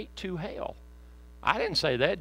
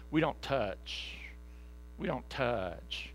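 slow, softer, a hint of prim refusal; mock holier-than-thou recoil; aloof; 3 semitones lower, 8 dB quieter, much slower than usual. Church members refusing contact with the world, prim and slow. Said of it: "so that we say".

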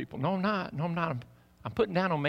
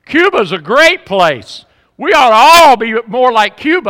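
mocking TV pundit; 9 semitones higher, 15 dB louder than usual. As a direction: ham up the pundit's gushing. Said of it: "people on T V go".

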